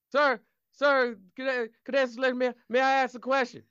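nervous cadet voice. Acted. Nervous cadet pleading to ask.

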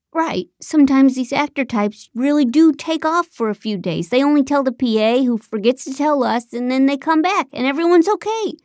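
jittery producer talking fast; nervous John. Acted. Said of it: "John chuckled nervously at his own joke".

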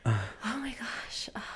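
breathless whisper after laughing; gasping to catch breath; after laughing hard. Real, not acted. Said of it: "I need to catch my breath".

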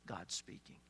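an awed whisper, breathy and hushed; whispered awe. Acted. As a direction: whisper it as if God might hear. Echo of previous line, whispered.

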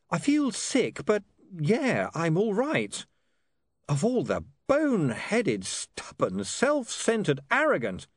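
high-pitched, exasperated son; rattled young man. Acted. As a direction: vent about your father, piling up insults.

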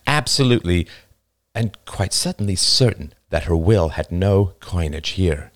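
plain reading voice

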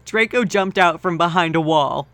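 usual speaking voice

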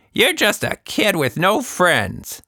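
put-on high, slow, mean old woman's jab; cruel taunt. A narrator's reading. Anita insulting Tilda, high and slow and mean. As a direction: sneer at the child. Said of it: "Anita slammed her fist".

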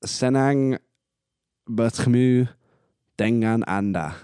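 Senang bertemu dengan Anda said slowly, word by word, with pauses; Indonesian phrase. Shown as a demonstration. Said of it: "and it is".

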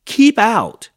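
high, very loud order; yelled. A narrator's reading. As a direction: yell to chase someone off. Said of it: "yelled Hank".

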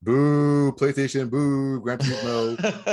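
long drawn-out jeer; loud, playful boos; mock booing. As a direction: heckle like a playful crowd booing. Jeering the rival console.

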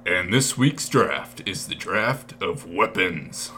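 slow, dramatic announcer drawl. Acted. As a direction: boom it out like a game-show host. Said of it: "That's a good one to end on".

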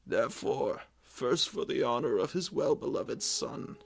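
king proclaiming his abdication, clear formal reading; clear proclamation. A narrator's reading. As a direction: read the royal decree clearly. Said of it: "read from it in a clear voice".

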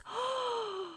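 sharp high startled gasp. A narrator's reading. Narrator gasps as the cone comes loose.

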